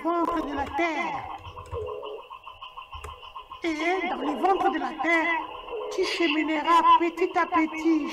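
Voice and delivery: very high cackling witch voice; old witch. Acted. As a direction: give orders like an old crone, laughing. The witch gives instructions. (vieille sorcière qui ricane, voix très aiguë)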